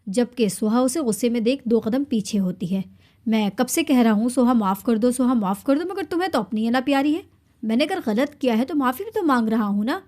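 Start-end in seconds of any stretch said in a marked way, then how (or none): none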